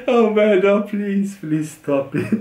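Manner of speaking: begging through helpless laughter; laughing plea. Real, not acted. Begging the joker to stop, out of control with laughter.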